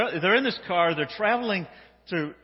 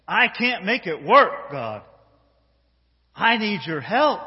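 loud, raised plea to God; pleading prayer; 3 semitones higher, 7 dB louder, slower than usual. Acted. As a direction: cry out to God for help.

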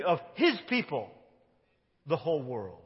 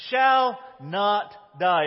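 shall not die, slow and louder, shall hit hard; stressed shall; 6 dB louder, much slower than usual. Acted. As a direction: land every word, shall first and strongest. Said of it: "this word "shall" is significant".

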